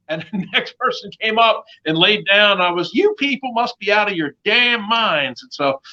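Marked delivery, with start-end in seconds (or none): 2.9-5.4 high, incredulous mock outrage; retold indignant outburst at a crowd; going off. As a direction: scold them in high-pitched disbelief. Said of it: "I went off".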